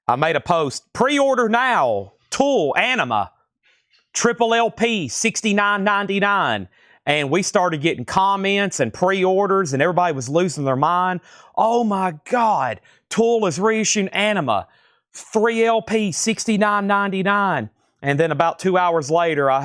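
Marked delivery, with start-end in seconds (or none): 0.9-6.7 oversell it like a big hype ad; hyped sales pitch. Retold or imitated. Host reading his April Fools post to viewers, hyped and high. 11.6-17.7 excited fans. Retold or imitated. Host imitating fans losing their minds over the fake reissue, gushing high.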